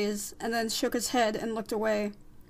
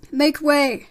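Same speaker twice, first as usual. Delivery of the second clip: loud, higher call to clear a path; 4 semitones higher, 11 dB louder than usual. Acted. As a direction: call out over a crowd. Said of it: "said Frollo".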